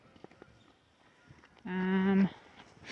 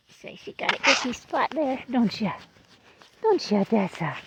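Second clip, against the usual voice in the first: pet talk; 3 semitones higher than usual. Real, not acted. Talking to her old goat.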